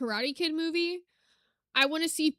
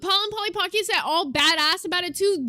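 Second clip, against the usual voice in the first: normal talking voice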